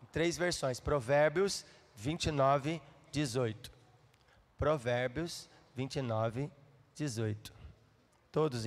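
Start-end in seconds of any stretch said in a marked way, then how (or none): 0.8-3.7 verse reference spaced out slowly; slow dictation. Real, not acted. Preacher announcing the verse in three versions, slow and deliberate. Repeats reference for those searching. 4.6-7.5 low, slow, spaced-out reference; slow dictation. Real, not acted. Preacher waiting for all to find the verse, slow and low. Repeats reference for those searching.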